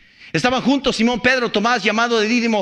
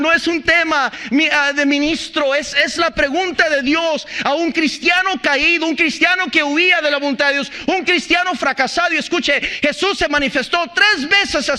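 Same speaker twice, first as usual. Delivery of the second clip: fervent preaching; 5 semitones higher than usual. Acted. Preaching climax.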